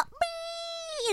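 tiny, very high, breathy meow; cat meow. Pretend. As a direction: meow like a little kitten. Voicing the cyst as a pet.

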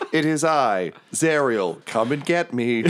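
high-pitched heroic announcement; Zariel. Acted. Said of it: "say that line as Ariel".

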